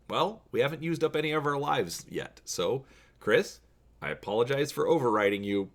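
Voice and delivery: plain reading voice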